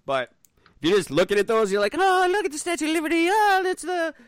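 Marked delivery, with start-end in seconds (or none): 2.0-4.1 high, fast gawking tourist voice; tourist impression. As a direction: play a dopey sightseeing tourist. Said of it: "That's my impression of a tourist in New York".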